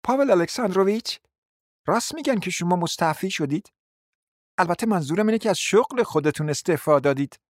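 nosy lady needling him about resigning; bold gossip. A narrator's reading. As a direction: ask with sly boldness. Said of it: "فلیسیتا میخایلوونای جسور ... پرسید".

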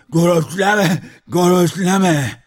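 loud, high slurred yell; mouth full of water. A narrator's reading. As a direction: shout through a mouthful of water, consonants gurgled. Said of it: "آب دهانش را پر کرده بود جواب داد". (فریاد با دهان پر از آب)

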